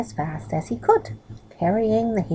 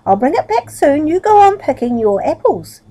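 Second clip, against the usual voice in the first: calling back; 8 semitones higher, 7 dB louder than usual. Acted. Middle still calling back to farmer.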